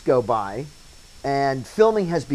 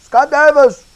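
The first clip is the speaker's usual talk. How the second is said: yelled name, much higher and louder; retold yell; 14 semitones higher, 13 dB louder, slower than usual. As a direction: call the name across to the house. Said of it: "he comes up and yells towards the".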